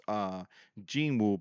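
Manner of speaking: ordinary talk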